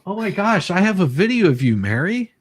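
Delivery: high and fast, bursting with excitement; suddenly thrilled, rushing to tell her; excited gasp of discovery, then rushing words. Real, not acted. Finds a video of Mary.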